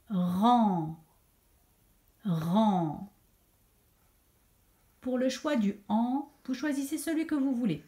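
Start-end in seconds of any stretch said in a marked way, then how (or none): 0.1-3.1 "rang" drawn out, twice over; dictated syllable. Shown as a demonstration. Teacher dictates a syllable to write, drawn-out "rang". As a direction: say "rang" slowly with a long nasal vowel, then repeat. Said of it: "vous allez écrire la syllabe".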